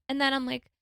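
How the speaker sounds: ordinary talk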